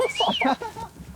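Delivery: high surprised laugh. Real, not acted. Reaction to emus.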